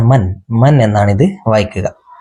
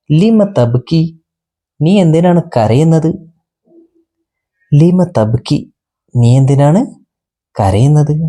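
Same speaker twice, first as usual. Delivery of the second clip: gentle lion; 5 semitones higher than usual. Acted. Lion asks the crying lamb.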